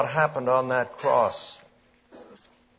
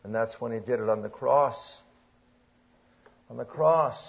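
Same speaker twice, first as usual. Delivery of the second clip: normal talking voice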